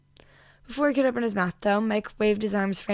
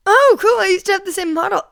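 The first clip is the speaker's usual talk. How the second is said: excited; 11 semitones higher, 12 dB louder than usual. A narrator's reading. Mike's excited squeal.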